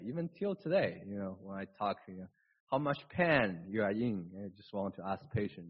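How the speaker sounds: ordinary talk, nothing marked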